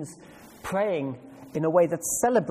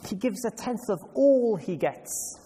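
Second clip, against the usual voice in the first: "all" drawn out and louder; stress on "all"; 6 semitones higher, 3 dB louder than usual. Shown as a demonstration. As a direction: lean hard and slow on the word "all". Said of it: "Emphasis on the word all".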